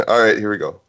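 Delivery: ordinary talk, nothing marked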